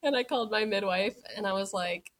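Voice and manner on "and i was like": ordinary talk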